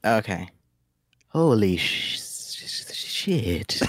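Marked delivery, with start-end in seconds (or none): 1.3-3.8 hissing snake in shock; a snake stunned and cursing. Acted. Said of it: "a snake who just found out he doesn't have legs".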